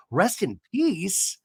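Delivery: drawn-out, slow "Rest in peace"; puzzled echo. Retold or imitated. Katie echoing the ladies, a puzzled, drawn-out "Rest in peace". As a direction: repeat "Rest in peace" slowly, stretching "peace".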